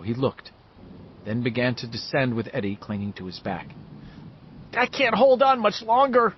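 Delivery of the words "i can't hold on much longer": high, strained plea; boy barely hanging on, high and pushing; straining boy. A narrator's reading. Eddie clinging to Bill's back, strained and high. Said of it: "Eddie managed".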